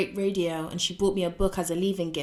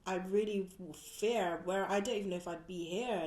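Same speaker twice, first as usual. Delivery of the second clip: teary and quiet; 9 dB quieter than usual. Real, not acted. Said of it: "Oh, tear up".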